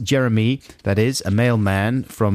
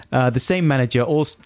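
ordinary delivery

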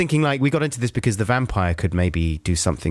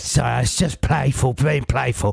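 1 lightly voiced dog; 2 semitones higher than usual. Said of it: "he's a dog".

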